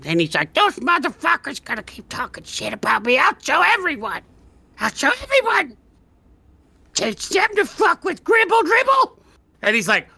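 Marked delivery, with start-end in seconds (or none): 0.5-9.2 high, rising ranting villain voice; squeaky little mad scientist working himself into a shout; tiny mad alchemist. Acted. Tiny alchemist ranting alone in his lab, squeaky and shouting.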